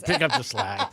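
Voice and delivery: laughing through the words; chuckling as he says it. Real, not acted. Repeats catchphrase laughing.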